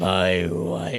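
deep put-on voice; low register. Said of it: "talk at this register".

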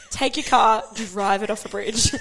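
usual speaking voice

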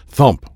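sharp punchy thump; thump sound effect. A narrator's reading. Narrator voicing the kick hitting the pad. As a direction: hit the word like a kick landing.

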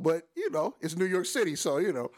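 usual speaking voice

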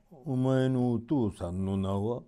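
put-on slow, honeyed old man's question; a lightly voiced scheming old stranger; sly old sorcerer. A narrator's reading. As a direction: coax the boy's name out slowly.

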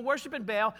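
ordinary delivery

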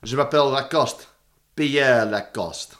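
slow, mock-suave French delivery; French accent. Podcaster playing the admiral as a French agent, French accent. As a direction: introduce a spy in drawn-out French. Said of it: "fun excuse to do accents more".

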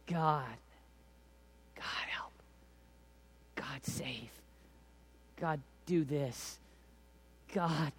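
soft, broken plea with long pauses; hushed halting prayer. Acted. Worshipper struggling for words before God, hushed halting plea. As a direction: pray it quietly, a few words at a time.

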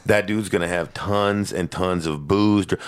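normal talking voice

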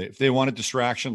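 ordinary talk, nothing marked

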